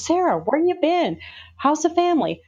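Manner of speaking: high, sing-out hello to a friend; retold bright greeting. Friend greets Sarah at the local Walmart, imitated high-pitched greeting.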